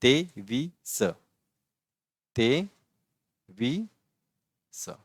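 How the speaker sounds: ते वि स, slow, one syllable at a time. Shown as a demonstration. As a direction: sound out each syllable slowly. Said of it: "ये क्या है?".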